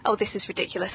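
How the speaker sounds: ordinary talk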